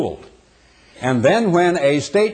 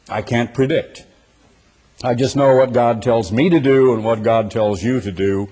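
ordinary talk

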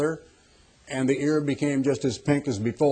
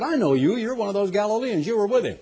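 higher, pointed accusation; accusing servant girl; 6 semitones higher, faster than usual. Retold or imitated. Servant girl accuses Peter by the fire, higher and pointed. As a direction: point the finger at him. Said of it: "a young girl came up and said".

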